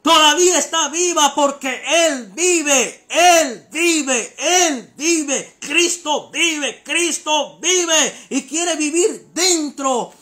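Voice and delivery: loud, high-pitched full-force sermon; fiery preaching. Real, not acted. Preacher urging listeners that Christ lives, fiery shouted preaching. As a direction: shout it to the crowd, drawing out the final words.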